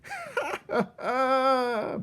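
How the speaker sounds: long high cackling laugh; villainous cackle. A narrator's reading. Botticelli cackles as the mouse threatens the rat. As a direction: cackle with cruel glee. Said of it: "Botticelli laughed from the sidelines".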